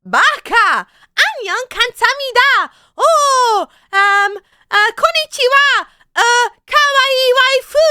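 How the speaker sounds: high, loud squealing anime fan; shrill-free high excited otaku voice, drawn out at the end; otaku impression. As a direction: gush like an over-the-top anime fan. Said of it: "I can do an otaku".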